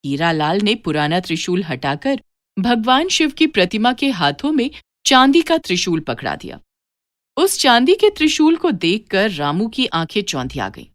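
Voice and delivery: usual speaking voice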